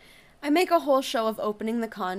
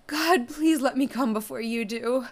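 breathless pleading; 3 semitones higher, 6 dB louder than usual. Acted. Climax pleading.

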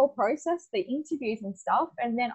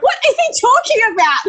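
baffled surprise; 14 semitones higher, 10 dB louder than usual. Retold or imitated. Baffled by boss's odd request.

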